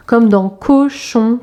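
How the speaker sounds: «comme dans cochon», dit lentement, le «co» bien appuyé; syllabe détachée. Shown as a demonstration. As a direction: articulez «cochon» avec soin, syllabe par syllabe. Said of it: "qui fait co". («cochon» prononcé lentement, son «co» marqué)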